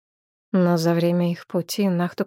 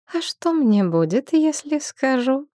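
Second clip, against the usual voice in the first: playful tease with a raised, drawn-out ending; coy teasing; 7 semitones higher than usual. A narrator's reading. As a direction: pitch lifting into a coy question.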